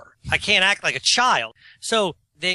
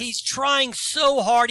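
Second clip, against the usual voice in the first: "so" stretched slow and high, mocking; stress on so; 6 semitones higher, much slower than usual. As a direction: drag out "so" like he tries way too hard. Mocking an eager new comic.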